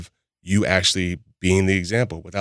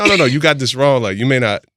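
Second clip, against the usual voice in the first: louder, higher mock objection; retold insistent protest; 6 semitones higher, 9 dB louder than usual. Man retelling how he argued back to partner, insistent protest. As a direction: cut in insistently, playing the argument. Acts himself arguing back to her.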